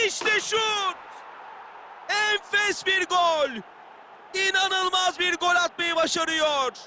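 high, drawn-out goal call; screaming football commentator. Real, not acted. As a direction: belt out the goal, stretching it. Goal scored, commentator calls it.

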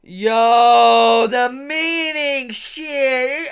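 loud, drawn-out disbelief; stunned outburst. Real, not acted. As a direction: let the shock burst out, stretching the words. Stunned reaction to foreshadowing.

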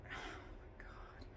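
breathy whispered groan of dread; exasperated whisper. Real, not acted. Whispered dread over a work Zoom call. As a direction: whisper it like you dread the meeting.

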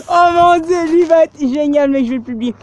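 shouting with excitement; loud thrilled outburst; excited shout. Real, not acted. Excited reaction to the joke.

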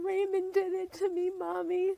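lightly voicing her crying son, pitch up; bawling little boy. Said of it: "he started bawling and he says".

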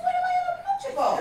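very high, fast, laughing disbelief; shocked squeal. Retold or imitated. Gina asking friend Tyra, fast laughing disbelief.

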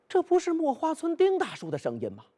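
ordinary delivery

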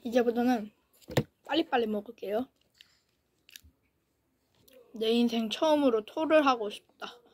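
chewing while talking; speaking between bites; mouth full. Real, not acted. Seen 0: bites a pastry, then chews with a full mouth.

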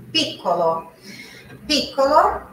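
Piccolo with the weight on PI, not on co; stress on first syllable. Shown as a demonstration. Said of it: "sílaba tônica no ti". (Piccolo com a tônica na primeira sílaba)

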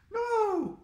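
very high, loud cry of no; heroic shout. Acted. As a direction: scream it at the villain. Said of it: "shouted Fluoride".

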